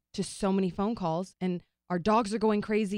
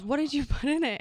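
higher, curious mother's voice; imitating Janet; 7 semitones higher than usual. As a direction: lightly play the boyfriend's mother asking. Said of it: "And Janet goes".

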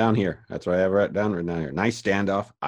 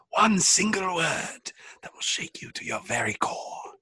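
much higher, slow, hammed-up theatrical voice, dropping to a whisper; mock dramatic Rip; 13 semitones higher than usual. Captain warning the crew of doom, hammed-up high and slow. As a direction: camp it up like a melodramatic prophet, then hush. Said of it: "Rip is like".

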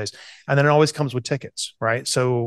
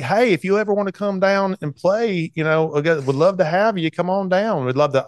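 put-on higher, warm Southern Georgia accent; 6 semitones higher than usual. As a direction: play the friendly Georgia host inviting him down, in a Georgia accent. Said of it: "I'm gonna do this in my Georgia accent".

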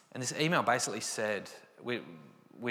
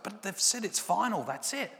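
higher, worried insistence; anxious protest; 10 semitones higher than usual. Retold or imitated. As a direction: colleague insists the email is final, anxious and high. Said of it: "He was like".

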